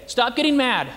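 ordinary talk

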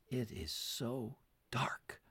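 barely whispered, soft and slow; hushed dying words. Retold or imitated. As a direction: let it fall to a frail breathless whisper. Dying grandfather's words retold.

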